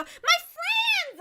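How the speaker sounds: squealed high and delighted; excited greeting. Acted. Greeting her friends after falling, high and thrilled. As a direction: burst out with joy at seeing friends. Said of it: "I go".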